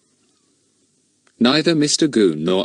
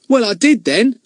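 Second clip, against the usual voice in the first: high, louder huffy retort; annoyed; 6 dB louder than usual. Acted. Ern insists to Larry, high and annoyed. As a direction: snap back, put out. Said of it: "looking annoyed".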